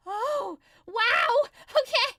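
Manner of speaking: exaggerated loud, high "oh, wow"; big mock outburst of amazement; too-loud reaction. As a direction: blurt it out at full volume, overplayed. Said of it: "didn't say it quite that loud".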